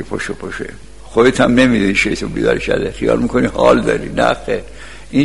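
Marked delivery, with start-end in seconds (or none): 0.0-0.6 softly murmured wake-up nudge; low and hushed, as the devil urging a sleeper; devil's coaxing. Acted. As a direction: quietly tempt someone out of bed. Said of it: "شیطون میگه".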